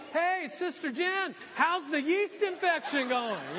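high, slow, drawn-out mock greeting; imitating an oblivious, hearty churchgoer; calling out. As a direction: call cheerily across the church, hammed up. Congregation imagined calling out to wife.

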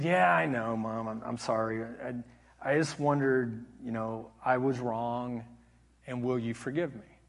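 low, soft, halting apology; retold contrite murmur; humble apology. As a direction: ask forgiveness quietly, hesitating. Son's retold apology to mother.

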